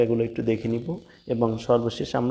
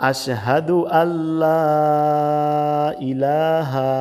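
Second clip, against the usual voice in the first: drawn-out Arabic recitation, higher and very slow; 3 semitones higher, much slower than usual. Shown as a demonstration. Teacher reciting tashahhud slowly, higher pitched. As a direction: chant it slowly for students to copy.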